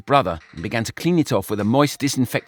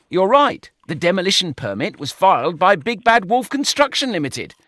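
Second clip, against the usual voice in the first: higher, louder, firm; confirming; 8 semitones higher, 5 dB louder than usual. A narrator's reading. Brian confirming the permit to George, firm. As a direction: state the finding flatly. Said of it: "Brian confirmed".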